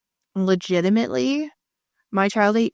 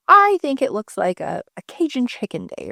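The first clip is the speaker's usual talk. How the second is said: chirpy mom; 5 semitones higher, 5 dB louder than usual. Retold or imitated. Mom picking dinner cheerfully.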